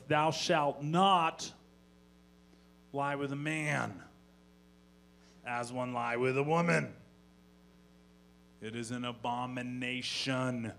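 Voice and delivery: slow, grave preacher reading; a pompous reading of Leviticus; solemn scripture. Pretend. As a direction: intone it like holy law. Quoting Bible solemnly.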